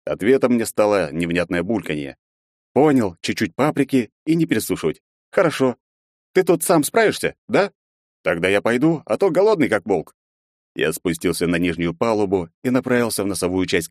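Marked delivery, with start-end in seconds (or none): 2.7-10.2 put-on slightly higher, breezy young man; lightly voiced cheerful hero. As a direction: toss it off cheerfully, a bit raised.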